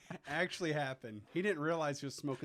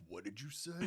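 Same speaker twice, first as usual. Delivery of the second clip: low, quiet warning from a dad; stern movie dad; 8 semitones lower, 10 dB quieter than usual. Retold or imitated. Movie dad confronts his son over a word, low and stern. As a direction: imitate a dad, dropped low and soft. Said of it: "His dad's like".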